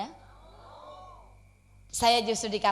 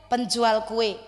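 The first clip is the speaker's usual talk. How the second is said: ordinary talk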